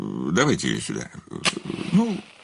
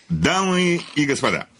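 higher, theatrical dictation with pauses; dictating a story; 4 semitones higher than usual. Acted. Writer dictates his radio play to his secretary, theatrical. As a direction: announce the story to an audience.

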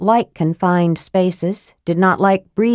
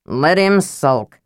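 slow, drawn-out disgust; slower than usual. A narrator's reading. Knight dismissing the sulking mage, disgusted. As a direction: stretch it out with disgust.

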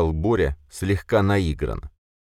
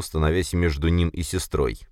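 ordinary delivery

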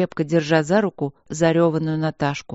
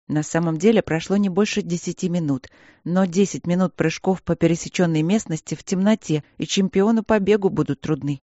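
ordinary delivery

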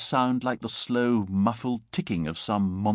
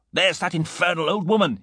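higher, indignant interruption; irritated outburst; 7 semitones higher, 4 dB louder than usual. A narrator's reading. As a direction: break in with exasperation. Gibbon interrupts, irritated at neighbour.

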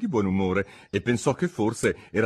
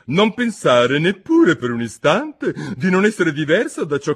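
higher, louder, pompous duchess; 7 semitones higher, 7 dB louder than usual. A narrator's reading. Duchess lectures Alice, pompous and higher. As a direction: preach a moral she cannot recall.

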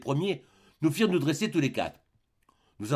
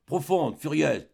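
usual speaking voice

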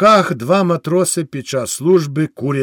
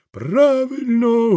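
slow, much higher crowd call of agreement; crowd shout; 8 semitones higher, much slower than usual. Acted. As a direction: call it out as the whole village. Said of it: "гукнув народ".

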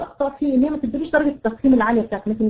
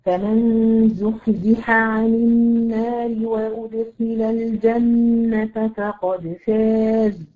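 slow, measured recitation of the verse; slow Quran recitation; much slower than usual. Shown as a demonstration. The teacher recites the verse slowly for her student. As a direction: chant it slowly and evenly. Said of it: "خلي بالك الحاء جنب العين".

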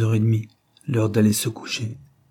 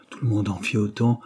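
ordinary delivery

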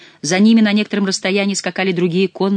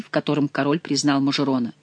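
usual speaking voice

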